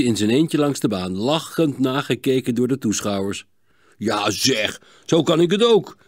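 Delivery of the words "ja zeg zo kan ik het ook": mildly exclaimed protest; calling out. A narrator's reading. Gerben watches Sietse skate alone, calling out. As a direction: call out a teasing objection. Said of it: "riep Gerben uit".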